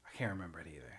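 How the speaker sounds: soft unvoiced whisper. Real, not acted. Host admitting to a co-host that they forgot the name, whispered. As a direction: breathe it out as a whisper. Trailing admission, voice drops.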